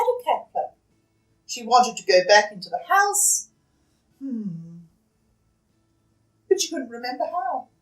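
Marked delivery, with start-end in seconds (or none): none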